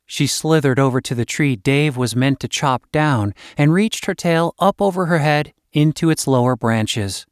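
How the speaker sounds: ordinary delivery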